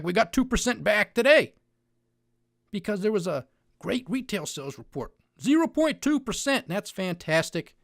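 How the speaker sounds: loud, high mock excitement over the numbers; exaggerated fake enthusiasm; sarcastic. Real, not acted. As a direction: hype it up sarcastically, then land drily. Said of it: "tell the sarcasm in my voice".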